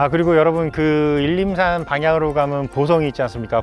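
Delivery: normal talking voice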